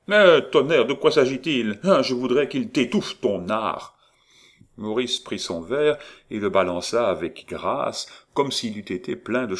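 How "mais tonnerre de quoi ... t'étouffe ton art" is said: loud, higher, exasperated roar; furious outburst. Acted. Étienne exploding at Maurice over his art. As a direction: blow up at him, fed up.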